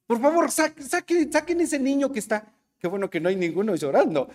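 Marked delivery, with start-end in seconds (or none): 0.1-2.5 mock apostle. Apostles ordering the crying child out.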